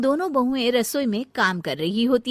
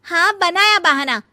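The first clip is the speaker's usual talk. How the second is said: loud, unapologetic comeback; defiant retort; 9 semitones higher, 6 dB louder than usual. Acted. Shivani admits the excuse to her sister-in-law, loudly defiant. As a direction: throw the accusation back at her. Caught shirker answers back.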